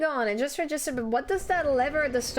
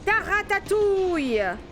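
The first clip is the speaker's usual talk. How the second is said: mock French outburst; 4 semitones higher than usual. Gamer playfully crying out in French mid-game, mock-dramatic. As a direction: cry out in hammed-up French.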